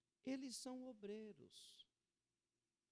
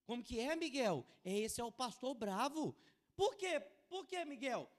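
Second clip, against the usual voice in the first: put-on loud, high questioning of his child; raised-voice mock interrogation; incredulous father; 6 semitones higher, 7 dB louder than usual. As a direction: imitate a dad pressing his son for answers. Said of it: "O pai dele não aguentou".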